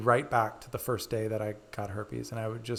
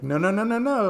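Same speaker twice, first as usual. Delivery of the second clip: high, rising run of no's; singsong, louder playful refusal; playful scolding; 7 semitones higher, 5 dB louder than usual. Retold or imitated. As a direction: tease with a mock telling-off. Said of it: "still, like, being playful about it".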